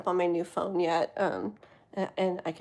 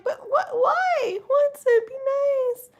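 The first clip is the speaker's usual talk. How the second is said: much higher squeaky mock complaint; mock whiny protest; 5 dB louder, slower than usual. To friend Wanson after the scalp joke, high mock whine. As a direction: whine it up high, pretending to be hurt. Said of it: "And I'm like".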